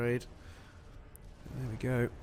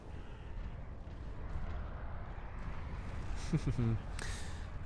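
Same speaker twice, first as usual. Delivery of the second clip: soft laugh; 2 semitones lower, 11 dB quieter than usual. Real, not acted. Caster laughs at island raid.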